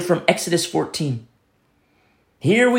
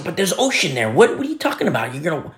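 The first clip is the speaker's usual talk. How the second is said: acted-out bewildered sceptic, pitch jumping up; carnal doubter; 3 semitones higher than usual. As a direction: protest in baffled disbelief. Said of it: "Carnally speaking".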